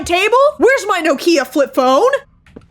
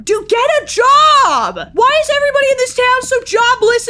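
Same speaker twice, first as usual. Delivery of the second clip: shouted scolding; 7 semitones higher, 3 dB louder than usual. Real, not acted. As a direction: yell it at the creep outside like he's wasting your time. Yelling at creepy man outside.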